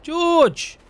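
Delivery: loud call, pitched high, across the yard. Real, not acted. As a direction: shout his name to find him. Calling out across the farm.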